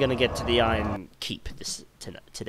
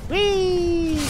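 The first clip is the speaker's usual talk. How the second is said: very high, loud squeal; gleeful "whee"; 6 dB louder than usual. Pretend. Gamer watching a fall, gleeful "whee". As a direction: squeal like going down a slide. Playing as another enemy falls.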